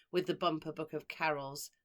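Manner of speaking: plain reading voice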